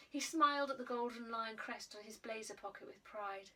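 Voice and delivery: plain reading voice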